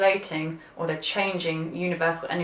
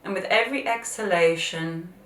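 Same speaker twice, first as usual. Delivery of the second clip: guided meditation. Acted.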